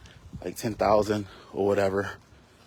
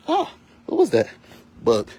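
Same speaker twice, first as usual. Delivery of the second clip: sudden high startled cry; 12 semitones higher, 4 dB louder than usual. Real, not acted. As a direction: caught off guard, voice jumping up. Startled by a bug.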